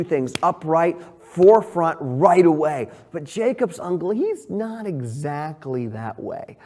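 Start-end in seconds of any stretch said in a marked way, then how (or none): none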